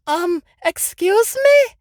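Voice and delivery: puzzled Piper. Retold or imitated. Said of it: "poor Piper's probably like".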